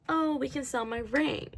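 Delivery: high-pitched quoted offer; fiancee offering help. Retold or imitated. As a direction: pitch it well up, as a mock helpful fiancee. Voicing fiancee offering her ring.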